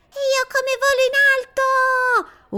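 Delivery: put-on very high, loud child's yell; shouting little girl. A narrator's reading. Girl yelling to Mido from the swing, very high and loud. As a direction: squeal it out flying on the swing. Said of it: "urla Marianna".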